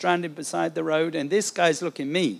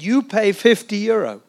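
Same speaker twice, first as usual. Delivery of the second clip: higher, louder imitated French accent; 5 semitones higher, 6 dB louder than usual. Policeman pointing at the traveller for money, French accent. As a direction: press the demand in a put-on French accent. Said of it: "he speaks in a kind of French accent".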